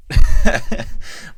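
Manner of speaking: short, loud burst of laughter; real loud chuckle. Real, not acted. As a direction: laugh out loud at your own joke. Laughing at his own joke.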